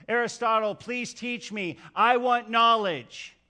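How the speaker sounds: loud, slow, earnest plea for teaching; a young man pleading loudly with his teacher; eager pleading student. Acted. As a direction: beg the master to teach you. Young man begs Aristotle.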